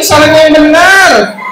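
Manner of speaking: emphatic correction. Acted. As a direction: stretch each word in exasperated teaching. Teacher corrects puppet emphatically.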